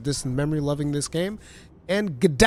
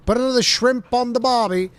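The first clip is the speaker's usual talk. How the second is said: high, hammed-up Aussie accent on the old line; mock Australian cliché; 8 semitones higher, 3 dB louder than usual. Streamer retelling what he told an Australian, in a mock Australian accent. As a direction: toss off the tourist catchphrase in a put-on Australian twang. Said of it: "the typical thing that everybody always says to Australian".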